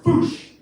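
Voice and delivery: loud, high-pitched impact noise; explosive vocal crash effect; imitated crash. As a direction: burst out the bang of a collision. Imitated buggy crash into door.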